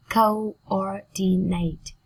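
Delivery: "coordinate" slowly split, CO, OR, DI, NATE; slow teaching breakdown of "coordinate"; syllable by syllable. Shown as a demonstration. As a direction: draw out each syllable of "coordinate".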